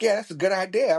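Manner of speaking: high, keen friend voice; mock-enthusiastic agreement; excited agreement. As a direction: chime in like an eager buddy. Voicing the keen friends again.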